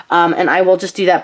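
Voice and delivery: normal talking voice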